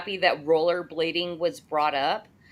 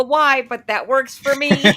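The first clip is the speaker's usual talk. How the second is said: higher, louder, a playful shrug in the voice; amused inner thought; 6 semitones higher, 6 dB louder than usual. Retold or imitated. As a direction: quote his own reaction with a light, amused lift. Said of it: "And I'm like".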